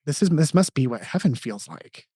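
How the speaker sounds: quietly retelling a choked-up son; a soft, tearful boy's voice, lightly voiced; son crying. Dad retells his crying son saying he is happy, softly tearful. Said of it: "And he started crying".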